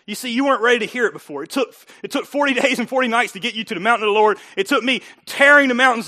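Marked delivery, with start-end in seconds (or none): none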